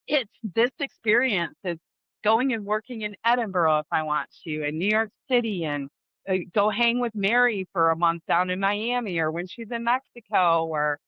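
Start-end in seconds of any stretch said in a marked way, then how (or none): none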